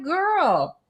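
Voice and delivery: high, knowing sass; sassy coworker. Retold or imitated. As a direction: tell her plainly, high. Coworker voiced in story.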